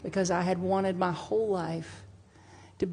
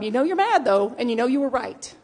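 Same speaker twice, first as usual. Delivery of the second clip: mock self-justifying; 5 semitones higher, 6 dB louder than usual. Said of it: "And you're like".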